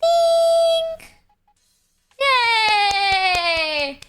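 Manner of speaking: high, bright excited cheer; cute cheer. Acted. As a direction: cheer like a cartoon. Cheering herself.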